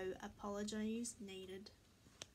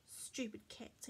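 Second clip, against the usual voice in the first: slow, low-key grumble; quiet, drawn-out sulky aside; grumbling to himself; 3 semitones lower, 7 dB quieter than usual. A narrator's reading. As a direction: mutter it slowly in mild disgust. Dog thinks grumpily about cats.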